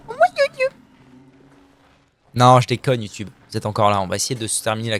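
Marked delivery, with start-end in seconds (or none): 0.1-0.7 extremely high tiny squeak; squeaky joke farewell. Pretend. Streamer pretending to bid YouTube goodbye, squeaky falsetto.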